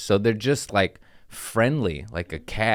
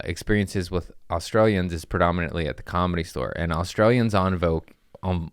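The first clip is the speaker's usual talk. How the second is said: usual speaking voice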